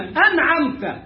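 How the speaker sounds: أنعمت drawn out with an open final تاء, aːnʕamta; slow correct model. Shown as a demonstration. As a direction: stretch the first vowel of أنعمت. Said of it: "وجايلك التاء مفتوحة".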